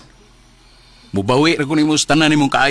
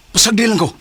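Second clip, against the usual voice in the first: irritated; 10 semitones higher, 4 dB louder than usual. Acted. Man shuts down a scolding friend, irritated and loud. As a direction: snap it higher and louder, fed up.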